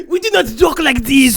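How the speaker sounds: high, loud laughing mock French accent. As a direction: huff it like an offended Frenchman. Playing offended French viewer.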